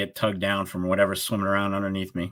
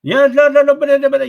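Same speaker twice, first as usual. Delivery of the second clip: high, loud frantic protest; mock frantic yell; 12 dB louder than usual. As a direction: yell it like a caller losing it. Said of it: "he's just going crazy on me".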